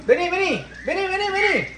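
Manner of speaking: high, rapid-fire pet call; coaxing call. Real, not acted. Owner calling the parrot to come, high coaxing. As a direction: coax the bird over with high repeated calls.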